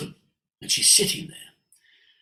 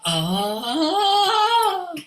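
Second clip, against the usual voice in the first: high "ah", swelling louder; imitated long "ah"; 5 dB louder than usual. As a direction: slide up and open out on a sustained "ah". Imitates woman's vocal exercise.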